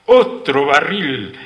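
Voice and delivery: high, loud, drawn-out call; eager shout. A narrator's reading. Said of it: "gritó frotándose las manos".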